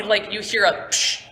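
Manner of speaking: normal talking voice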